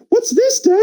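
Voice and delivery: put-on very high little kid voice; child voice. Baby Vince opens a present, squeaky child voice. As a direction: squeak it like a four-year-old. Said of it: "he's just like".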